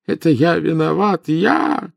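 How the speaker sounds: high, tearful wail of guilt; anguished high-pitched confession; sobbing cry. A narrator's reading. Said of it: "рыдая воскликнул он".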